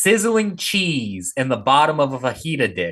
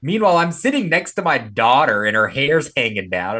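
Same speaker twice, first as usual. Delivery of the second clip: normal talking voice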